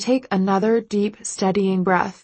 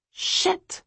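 high breathy whispered curse; frightened voiceless hiss; whispered panicked curse; 12 semitones higher, 4 dB quieter than usual. A narrator's reading. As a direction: gasp the swear out in dread. Inner panic.

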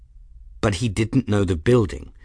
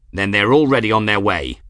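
forceful, louder snarl; 5 dB louder than usual. A narrator's reading. Aeronaut realising the enemy is escaping, angry snarl. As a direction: snarl it angrily. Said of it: "Kettle snarled under his breath".

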